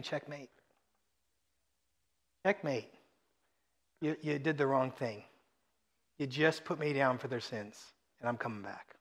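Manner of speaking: soft, low checkmate to the devil; quiet taunt. Acted. Jesus quietly telling the devil checkmate, a soft taunt. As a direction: murmur it low, like a quiet win.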